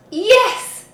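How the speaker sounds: high, loud cheer; shouting. A narrator's reading. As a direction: cheer it together. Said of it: "shouted the pirates".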